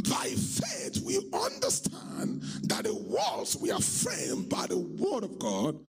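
high, drawn-out preaching cry. Real, not acted. Preacher proclaims a verse on faith to the congregation, preaching. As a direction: proclaim each phrase high and slowly. Preaches scripture at full force.

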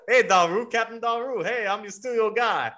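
high, cheery sailor's hello, pitched well up; Jake the Triton. Acted. The Triton bosun greets his old crewmates, high cheery voice. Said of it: "he's like".

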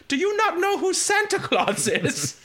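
put-on incredulous old woman. Prankster voice to the scammer.

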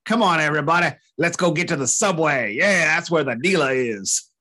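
put-on jokey New York accent, rallying the crowd. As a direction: put on a mock New Yorker voice. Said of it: "New York accent".

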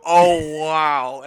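loud, high exclamation; loud Oh, wow. Retold or imitated. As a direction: belt out a surprised wow. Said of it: "We always go".

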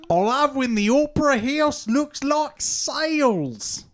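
over-the-top Australian tourist voice; Australian accent. Acted. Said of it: "Voiced by Johnny Australia".

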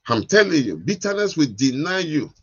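loud, driving preacher's call; preaching at full force. Acted. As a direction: hammer it home to the congregation.

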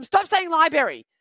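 high, loud mock scold of a child. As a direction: snap at the kid like an impatient parent. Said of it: "we're not gonna scold them".

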